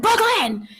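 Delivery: high sing-song call of a name; imitating calling her daughter from downstairs. Mother calling daughter, retold.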